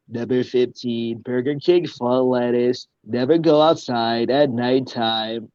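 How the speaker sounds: slow, deliberate list-reader voice; YouTube guy impression. Shown as a demonstration. Entertainer demonstrates a voice for the interviewer, YouTube guy impression. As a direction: count it off slowly like a video narrator. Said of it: "I can do that guy from YouTube".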